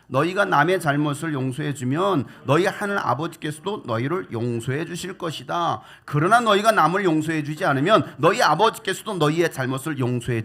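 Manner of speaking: loud unison reading of the verse; group scripture reading. Real, not acted. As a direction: read it out together, firmly. Congregation reads scripture aloud together.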